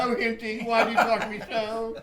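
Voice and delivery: giggling bum character bit; homeless guy character. Acted. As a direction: ham up a rambling homeless character through laughter. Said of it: "We would do Higher and Hinty".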